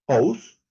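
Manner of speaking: "house" with no h, starting on the vowel; "house" as a loud "ous", no breath first; silent H. Shown as a demonstration. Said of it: "No es".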